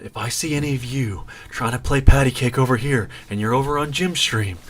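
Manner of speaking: lightly voiced supervillain, imitating with mild menace; mock supervillain. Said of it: "supervillain voice he's talking in".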